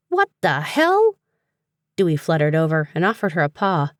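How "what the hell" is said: much higher, drawn-out disbelief; startled. A narrator's reading. Tripping away from a talking book, startled. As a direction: blurt it in high, slow shock.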